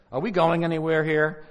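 acted impatience. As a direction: complain like you can't wait any longer. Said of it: "I get impatient".

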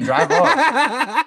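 high, laughing drive off; laughing command. Retold or imitated. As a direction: laugh while ordering him off. Telling guy to leave, laughing.

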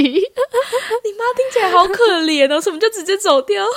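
fake-pitying while cracking up; laughing mock pity. Real, not acted. Teasing her friend about her poor mom, laughing.

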